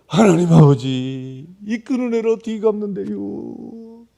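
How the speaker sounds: slow, loud Chungcheong dialect plea; loud Chungcheong prayer. Retold or imitated. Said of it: "충청도 말로다가". (크고 느린 충청도 사투리 기도)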